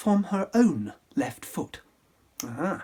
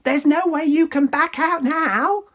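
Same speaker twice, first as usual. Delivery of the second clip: high, loud shrieking sister; higher, much louder fishwife yell; angry screech; 11 semitones higher, 7 dB louder than usual. Acted. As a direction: scold him at full pitch. Ugly sister shrieks at prince.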